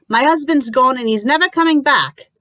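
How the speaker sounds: high loud cry of loss; anguished shout. Acted. Lucy shouting at the landlady about her husband, anguished and loud. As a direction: throw the grief at her.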